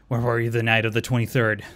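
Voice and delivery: mock interrogator. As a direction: fire it off like a joking detective. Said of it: "this sounds like I'm at the airport and I'm being asked a lot of questions".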